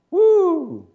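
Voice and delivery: high, loud "woo"; sudden high-pitched cheer; excited whoop. Acted. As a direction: whoop like a thrilled congregation. Mock excitement at fire showing up.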